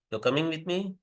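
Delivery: "You're coming with me" climbing steadily to a questioning end; rising intonation. Shown as a demonstration. Lecturer adds interrogative intonation to a statement, rising. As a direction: let "You're coming with me" rise through to "me". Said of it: "a rising intonation".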